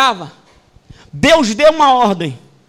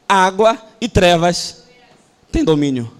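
ordinary talk, nothing marked

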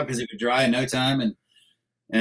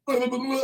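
gurgling flail; 12 semitones higher, 3 dB quieter than usual. Retold or imitated. Sinking into septic tank, struggling.

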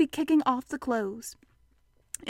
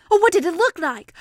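higher, louder, keen to know; eager question; 6 semitones higher, 7 dB louder than usual. Acted. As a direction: ask with lively curiosity. Christopher Robin questions Piglet eagerly.

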